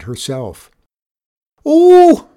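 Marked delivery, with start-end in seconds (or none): 1.6-2.3 huge, very high, very loud bellow; desperate bellow. A narrator's reading. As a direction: roar at the bear with everything she has. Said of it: "she bellowed desperately".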